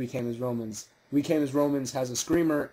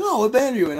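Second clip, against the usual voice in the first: clueless fan question; 6 semitones higher, 6 dB louder, faster than usual. Retold or imitated. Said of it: "he's like".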